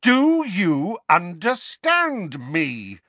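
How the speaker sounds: high, loud, very slow demand; furious shout. A narrator's reading. Harry demands an answer from his cousin, shouting furiously. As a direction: shout each word slowly.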